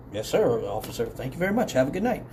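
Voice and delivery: soft, deferential reply; retold subdued courtesy; meek politeness. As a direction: answer the officer humbly and quietly. Meek reply to dismissing officer.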